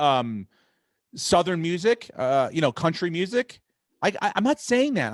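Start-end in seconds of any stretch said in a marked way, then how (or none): none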